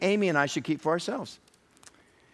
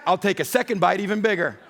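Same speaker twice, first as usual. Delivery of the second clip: higher, louder gleeful boast with laughter; gleeful teasing; 5 semitones higher, 5 dB louder than usual. Retold or imitated. Dad teasing his kids over food, gleeful laughing. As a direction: gloat playfully like a greedy dad. Acts greedy dad taking bites.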